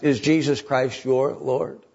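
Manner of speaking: normal talking voice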